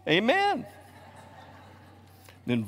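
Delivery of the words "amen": joyful glory amen. Retold or imitated.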